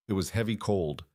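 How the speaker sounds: usual speaking voice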